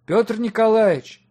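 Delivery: high, loud searching call; drawn-out shout up from below. A narrator's reading. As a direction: call out for someone out of sight. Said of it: "Снизу послышался голос Воропаева".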